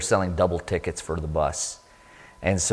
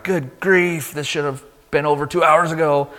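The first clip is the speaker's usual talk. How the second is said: raised-pitch retold exasperation; frustrated; 6 semitones higher than usual. Passenger gets off a late bus, frustrated. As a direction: grumble it high, fed up with the delay. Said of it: "I'm frustrated and I'm like".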